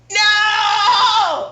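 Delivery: long, high, loud wail of no; hammed-up drawn-out cry of protest; dramatic shout. Mock outcry at decriminalization news.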